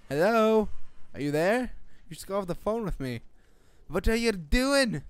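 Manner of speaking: hammed-up demanding call; mock impatient caller. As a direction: act out pestering someone on the phone.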